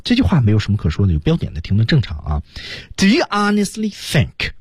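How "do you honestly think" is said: slow, high, stopping after "think"; pause after think. Shown as a demonstration. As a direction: draw out up to "think", then pause. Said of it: "这儿有一个停顿". (慢速高声读到"think"后停顿)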